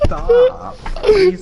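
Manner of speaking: high, loud, breathless with laughter; can't stop laughing; laughing hard. Real, not acted. Crying from laughter.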